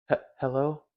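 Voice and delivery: hesitant, very soft hello; quiet, unsure mock pickup; imitated phone greeting. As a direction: answer softly and haltingly, putting it on. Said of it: "I ain't sound like this".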